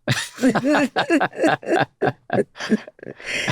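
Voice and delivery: big laugh breaking out, high; burst of high laughter. Real, not acted. Son laughing at his dying father's joke, big high laugh. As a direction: laugh hard at the memory.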